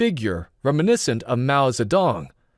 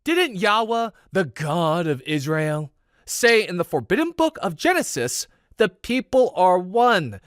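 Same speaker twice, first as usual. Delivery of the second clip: fervent intensity; 5 semitones higher than usual. A narrator's reading. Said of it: "He pressed on with fervent intensity".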